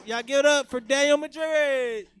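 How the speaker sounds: loud, drawn-out MC intro; stage introduction shout. Real, not acted. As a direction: belt out the name to hype the crowd. Host announcing the next comic.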